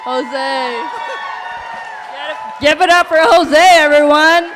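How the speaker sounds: loud, high, hyped-up shouting; fashion show emcee. Real, not acted. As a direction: hype the winner down the runway. Emcee calls winner onto runway.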